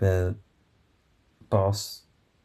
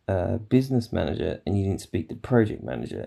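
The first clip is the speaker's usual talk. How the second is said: ordinary talk, nothing marked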